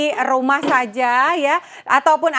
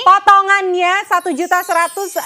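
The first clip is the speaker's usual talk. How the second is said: upbeat sales pitch; infomercial; 5 semitones higher than usual. Acted. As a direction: sell the discount with energy. Price pitch.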